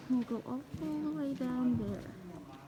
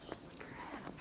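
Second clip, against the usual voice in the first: whisper; 14 dB quieter than usual. Real, not acted. Awed whisper in huge room.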